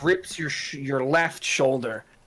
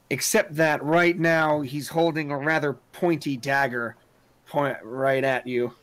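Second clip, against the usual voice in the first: usual speaking voice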